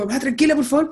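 calming mother, high and tense; tensa, alta. Retold or imitated. Calmando a mamá en terremoto. (calmando a su mamá asustada, voz alta y tensa)

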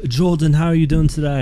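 hammed-up fake British co-host; terrible British accent. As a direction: ask it in a badly faked London accent. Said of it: "those are terrible accents".

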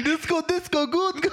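very high, silly mock accent; Zohan. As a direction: squeal it in a parody accent. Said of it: "he sounds like Zohan".

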